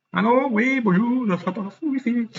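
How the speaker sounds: mock phone answer. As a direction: play someone picking up the phone, singsong. Mock phone call about wifi.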